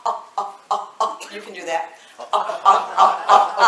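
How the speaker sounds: laughing glottal ah demo; glottal attack ahs. Shown as a demonstration. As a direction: pop each ah off the vocal cords. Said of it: "that's a glottal attack".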